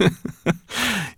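high-pitched amused giggle. Acted. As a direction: let a giggle bubble up high. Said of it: "giggling at the sight of him".

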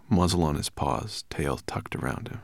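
ordinary delivery

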